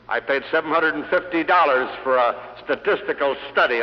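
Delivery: ordinary talk, nothing marked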